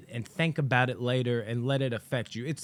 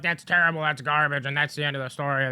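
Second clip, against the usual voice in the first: mocking detractors; 2 semitones higher, 4 dB louder, faster than usual. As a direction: imitate a critic writing it off. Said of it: "I'm mocking the people that are like".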